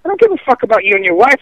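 usual speaking voice

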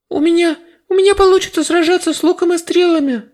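high, loud stammering plea; desperate high plea. A narrator's reading. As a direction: plead with a stammer. Another fighter pleads desperately.

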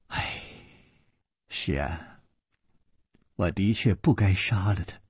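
heavy sigh, low and soft, full of regret; long sigh. Acted. Wife admitting she killed the assassin, sighing low. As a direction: let the words sink on a long sigh. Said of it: "长叹道".

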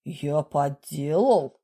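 slow, low offended question. A narrator's reading. Boss answers a forgery accusation, offended. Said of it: "Обиделся Василий Андреевич".

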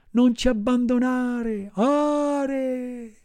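high, slow echoing cat voice; cat's echo. Acted. Blind cat echoing the fox's plea, high and slow. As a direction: drag it out like a sly cat. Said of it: "ripeté il gatto".

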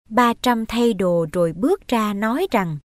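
plain reading voice

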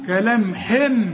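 كلام حم ending in a hummed m; lips closed on m. Shown as a demonstration. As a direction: shut the lips on the م of حم and hold. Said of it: "وأقفل شفايفي".

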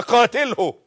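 loud, high order; preached command. Shown as a demonstration. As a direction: bark قاتله as a command. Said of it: "أوامر النبي اهي". (أمر قاطع بصوت عال: قاتله)

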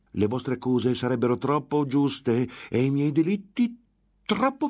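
grida alte e forti; il monaco furioso le nega la libertà; furia crescente. A narrator's reading. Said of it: "la furia lo riprese". (collera del monaco che esplode)

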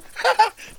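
high, scornful burst of laughter; a mocking cackle of disbelief. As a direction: laugh the comparison off. Sancho mocks Dulcinea's beauty.

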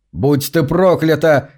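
usual speaking voice

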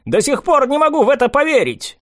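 high, far-off excited outburst; excited exclaim. Acted. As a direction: burst out in disbelief. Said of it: "воскликнул".

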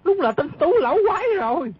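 high, rapid burst of alarm; alarmed cry. Acted. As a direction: blurt it out high, jumping up in panic. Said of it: "nhảy chồm chồm lên nói". (giọng cao vút, hoảng hốt)

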